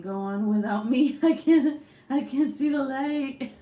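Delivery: loud, high, melodramatic collapse; mock dying plea. Would-be trainer collapsed after one jumping jack, mock dying wail.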